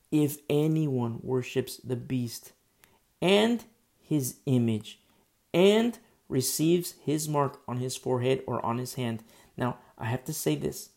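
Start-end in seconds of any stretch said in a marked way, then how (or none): 0.1-9.2 slow reading, each "and" stretched and stressed; emphasis on "and". Shown as a demonstration. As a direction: lean hard on every "and". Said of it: "I specifically said "and" with emphasis".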